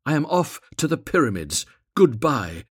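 high, loud huffy farewell; indignant cry. A narrator's reading. Swallow leaving the reed, loud indignant parting cry. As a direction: announce the departure loudly, in a huff. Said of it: "he cried".

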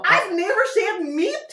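high, loud protest; loud playful indignation; indignant denial. Real, not acted. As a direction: deny it, outraged and high-pitched.

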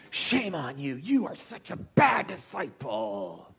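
slow, mock-stern scolding Jesus. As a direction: scold like a disappointed teacher, slowly. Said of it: "that's what he says to me".

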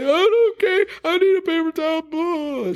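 high-pitched imitated crying; hammed-up tearful blubbering; mock sobbing. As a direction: wail it like a hysterical client, far above your voice. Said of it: "sobbing and crazy and crying".

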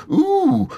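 high squeaky ooh; a tiny startled squeak. A narrator's reading. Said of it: "made a squeaky noise".

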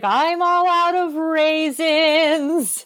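high, very drawn-out mock wail; hammed-up, slow sing-song moan; sing-song grandma. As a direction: stretch it into a doleful grandma lament.

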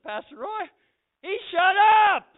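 loud, high excited outburst; imitating a thrilled convert; amazed report. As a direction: blurt out the astonishing news. Acts out rehab men's amazed report.

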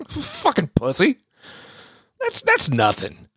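high-pitched, laughing jeer; scornful laugh. Real, not acted. Laughing scorn after mock threat.

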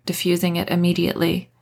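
plain reading voice

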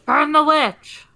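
shouted high and loud; rally the mob to burn her; angry mob leader. Pretend. Mock mob cry.

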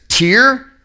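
TIER rhyming with here; drawn-out ee sound in tier; tear as teer. Shown as a demonstration. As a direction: say "tier" with an ee vowel. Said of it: "They all have different meanings".

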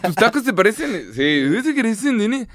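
mock Chinese accent. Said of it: "chino y dice".

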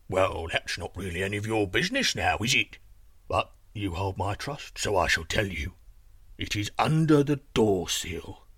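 low, rumbling giant voice; gruff giant grudgingly confiding. Acted. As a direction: let the secret out as a deep-voiced giant.

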